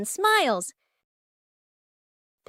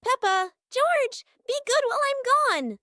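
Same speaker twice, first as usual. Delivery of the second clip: lightly voiced mother, higher pitch; mother's reminder; 8 semitones higher than usual. A narrator's reading. Mummy Pig reminds the kids before leaving for work, lightly voiced and higher. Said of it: "She reminds the kids".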